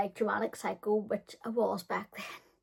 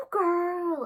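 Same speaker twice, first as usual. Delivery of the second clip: put-on big high wailing sigh, then a whiny girl; exaggerated sigh; 9 semitones higher, 5 dB louder than usual. Telling a heartbreak story, she gives a huge wailing sigh. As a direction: sigh like the world just ended.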